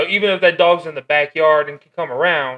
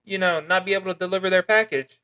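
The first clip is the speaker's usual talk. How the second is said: higher, soft customer service voice; nice calm voice; 6 semitones higher, 5 dB quieter than usual. Shown as a demonstration. Driver reasoning with a customer's dog, soft calm voice. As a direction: explain it gently, pitched up and soft. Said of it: "I talk in a nice, calm voice".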